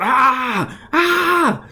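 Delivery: high pained screams from the arm bar; screaming out. Acted. Fighter caught in an arm bar screams out in pain. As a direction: cry out in agony, pitch climbing. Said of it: "He's screaming out".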